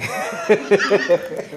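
high bursting laugh at her own joke; real laugh. Real, not acted. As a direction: let the laugh break out.

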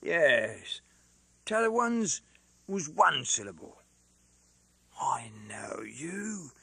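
lightly voiced rough Londoner; Mister Cruncher, hoarse. A narrator's reading. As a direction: blurt in as a blunt, hoarse fellow.

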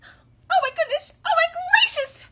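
very high, loud strained chick; Chicken Little. A narrator's reading. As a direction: squeal it in worn-out panic. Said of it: "rasped Chicken Little".

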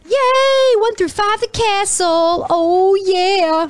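high, drawn-out cheer; high excited whoop, stretched out; gleeful celebration. Real, not acted. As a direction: squeal it with delight. Celebrating a new level.

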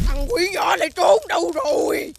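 high, slow shouted call. Acted. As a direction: call out to find the little one. Demon wife calls husband.

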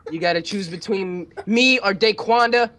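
high-pitched, dramatic demand; sassy jealous lover. Acted. Jokingly making a friend choose between lovers, high and sassy. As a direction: play a wronged lover, pitch climbing high.

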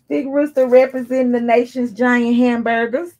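bright, loud hype for the brand; excited announcement. Real, not acted. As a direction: announce it like a shout-out.

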